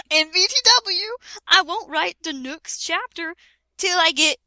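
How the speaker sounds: high, loud, helpless laughter while reading; can barely get the words out for laughing; laughing through it. Real, not acted. Reading a cringe author note aloud, cracking up.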